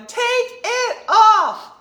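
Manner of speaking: very high, loud, drawn-out whoop; mom's mock holler. Acting out mom egging on the tank top man, shrieked long holler. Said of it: "my mom's going".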